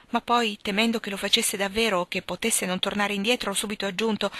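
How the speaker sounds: ordinary delivery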